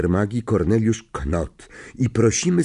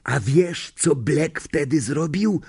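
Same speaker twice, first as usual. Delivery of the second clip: raised-pitch stage whisper; dramatic whisper; 7 semitones higher, slower than usual. A narrator's reading. Stan baits Harry with the next detail, dramatic whisper. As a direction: tease the secret with hushed drama. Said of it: "dodał dramatycznym szeptem".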